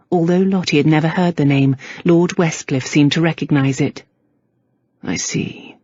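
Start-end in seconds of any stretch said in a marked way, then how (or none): 5.0-5.8 softly spoken, almost a whisper. A narrator's reading. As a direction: say it softly under the breath. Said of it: "he said softly".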